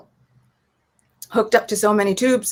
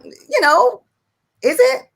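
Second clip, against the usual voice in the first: mock skeptical, voice jumping much higher; is it really, playfully sceptical; doubting teen; 8 semitones higher than usual. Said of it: "was kinda like".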